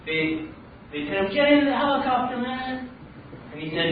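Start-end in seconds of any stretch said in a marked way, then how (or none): 1.3-2.9 high, raised-voice order; acted holler from a rescuer; urgent calling out. Soldiers in helicopter to drowning man, urgent high call. Armed forces shouting from helicopter.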